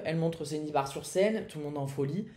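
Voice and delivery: normal talking voice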